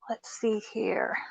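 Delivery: a soft unvoiced aside, slow; whispered to herself. Real, not acted. Digging through the box, whispering to herself. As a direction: whisper it while searching. Rummaging through her craft haul.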